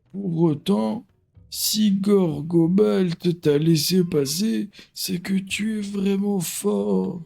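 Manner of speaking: put-on slow, high old elder; old man voice. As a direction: read it like an ancient wise elder. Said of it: "J'essaie de faire une voix de vieux".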